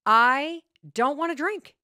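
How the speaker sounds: husband telling his wife to stop pushing wine, firmly annoyed; frustrated husband. Retold or imitated. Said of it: "He looks at me very frustrated".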